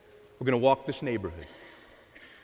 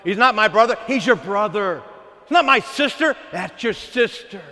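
much higher and louder, preaching at full force; shouting; 8 semitones higher, 9 dB louder than usual. Shown as a demonstration. Preacher to the church, shouting at full force. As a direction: hammer it home to the pews. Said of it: "you got to rejoice with your brother".

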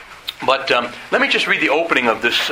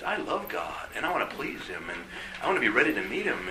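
tender hush; 11 dB quieter than usual. Acted. Tender prayer voiced.

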